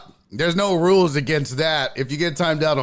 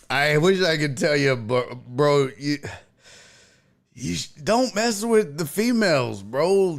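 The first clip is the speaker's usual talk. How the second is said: ordinary talk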